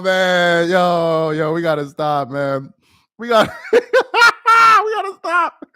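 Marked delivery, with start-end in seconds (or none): 3.2-5.8 much higher and louder, slow, through laughter; can barely get the words out for laughing; laughing hard. Real, not acted. Cracking up at the honey joke.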